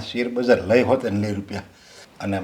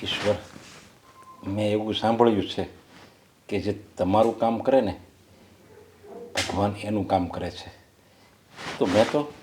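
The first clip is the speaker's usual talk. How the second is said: despairing prayer. Acted. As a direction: beg God for mercy under your breath. Father prays to God, desperate.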